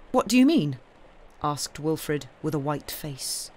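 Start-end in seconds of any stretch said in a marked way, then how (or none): 0.1-0.8 alarmed. A narrator's reading. Alarmed Wilfred challenges the cobbler.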